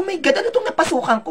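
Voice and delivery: high, fast retold surprise; a high, quick "my God" of alarm; shocked disbelief. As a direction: gasp it out, shocked at what he stumbled into. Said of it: "Nagulat ako".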